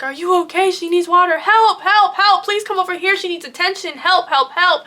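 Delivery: high-pitched, urgent retold pleading; frantic calls for help. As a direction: call out desperately for someone to help. Friend calling help for injured cousin.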